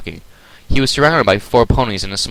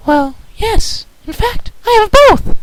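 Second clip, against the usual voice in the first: timid Fluttershy; 4 dB louder, slower than usual. Acted. Fluttershy voiced.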